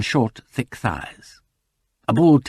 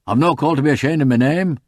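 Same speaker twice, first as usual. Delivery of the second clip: higher, louder touch of defiance; defiant farmer; 6 semitones higher, 6 dB louder than usual. A narrator's reading. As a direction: bristle at the stranger's question. Farmer bristles at the visitor.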